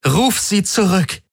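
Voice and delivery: very high, slow, strained order; trembling desperation. A narrator's reading. Commander cornered by two fronts, desperate high order. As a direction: stretch the command out in distress. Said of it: "das Zittern in ihrer Stimme".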